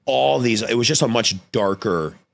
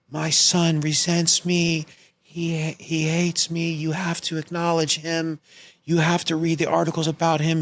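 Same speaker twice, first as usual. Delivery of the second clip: mocking a drunk mother's tearful plea, much higher; melodramatic drunk mother; 10 semitones higher than usual. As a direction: ham up a self-pitying mother's voicemail. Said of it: "leaves a Oscar-worthy performance".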